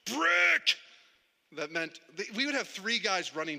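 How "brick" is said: very high, strained imitation of a bricklayer's yell. Bricklayer to his runners, imitated yell. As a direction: holler for supplies in a far higher voice. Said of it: "He'd yell".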